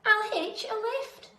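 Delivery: high, loud eureka cry; a tiny snail's sudden idea, high and loud; excited cry. A narrator's reading. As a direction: burst out with the idea. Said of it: "then cried".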